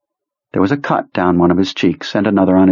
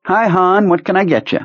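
much higher, chummy diner waitress; 11 semitones higher than usual. Acted. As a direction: greet him like a friendly waitress.

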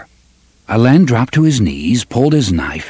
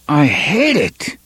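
slow, forced through clenched teeth; 6 semitones higher, slower than usual. Acted. Cuthbert at the canyon, through clenched teeth. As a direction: bite the words back. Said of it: "through clenched teeth".

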